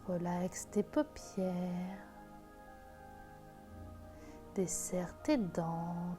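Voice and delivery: slow, soft meditation voice; guided meditation. Acted. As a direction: guide them into calm, gently. Leads a guided visualisation.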